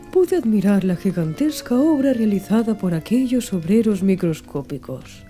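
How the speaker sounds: ordinary delivery, nothing marked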